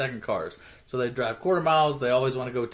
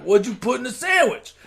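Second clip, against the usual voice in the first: surprised; 9 semitones higher, 4 dB louder than usual. Retold or imitated. Dom amazed by the fight.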